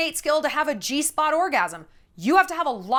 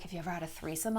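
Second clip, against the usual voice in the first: much softer, low bedroom murmur; hushed question; 7 semitones lower, 11 dB quieter, faster than usual. Retold or imitated. The young boyfriend asking her, in a hushed murmur. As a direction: ask it softly, close and intimate. Retold intimate night talk.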